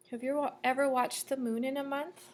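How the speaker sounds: normal talking voice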